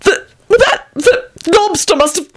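very high, stammering panic; terrified. A narrator's reading. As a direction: stammer it out in squeaky terror. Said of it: "rattled out in a terrified voice".

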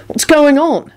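high, demanding question. A narrator's reading. Harry questioning Lupin at the meeting, demanding. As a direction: insist on an answer. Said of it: "Harry demanded".

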